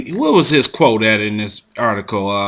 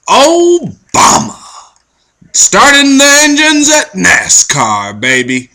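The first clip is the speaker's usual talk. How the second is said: high, slow, drawn-out mock announcer call; 11 semitones higher, 3 dB louder, slower than usual. Mocking Michelle Obama starting NASCAR engines, hammed-up high announcer drawl. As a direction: stretch it out like a showman hyping the crowd.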